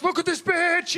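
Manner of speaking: high sassy exclamation; sassy persona. Retold or imitated. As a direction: switch to a brash diva. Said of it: "I'm like".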